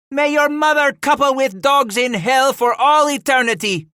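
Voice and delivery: very high, loud curse; bawling. A narrator's reading. The matriarch curses the Rem brothers, bawling. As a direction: bawl the curse at your enemies. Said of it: "she bawled at the Rem brothers".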